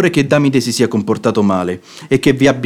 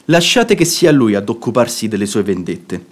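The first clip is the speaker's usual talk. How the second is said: ordinary talk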